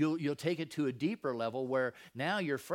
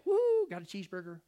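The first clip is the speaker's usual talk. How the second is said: high, loud whoop of delight; mock-celebrate with a big gleeful whoop; happy, joyful; 7 semitones higher, 7 dB louder than usual.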